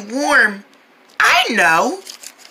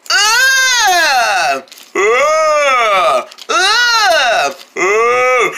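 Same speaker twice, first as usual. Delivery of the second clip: loud fake yawn; 2 semitones higher, much slower than usual. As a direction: show off a huge yawn. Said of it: "wanna hear me yawn so loud".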